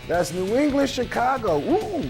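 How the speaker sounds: ordinary delivery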